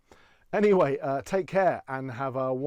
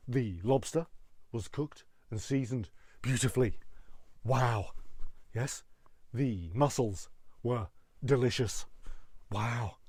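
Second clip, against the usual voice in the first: Gordon Ramsay; 2 semitones lower than usual. Acted. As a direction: praise given in halting bursts with mild irritation. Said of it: "even when he's handing out praise".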